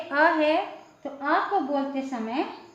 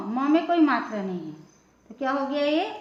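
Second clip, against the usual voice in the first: ordinary delivery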